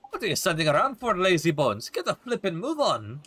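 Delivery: put-on fast, pushy Welsh accent; brash Welsh scolding, quick; impatient Welsh sidekick. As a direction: hurry them along in a Welsh lilt. Voices Drippy, urging.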